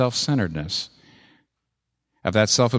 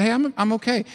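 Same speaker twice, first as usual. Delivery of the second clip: smug self-assurance; 11 semitones higher than usual. Retold or imitated. Paul's smug self-assessment.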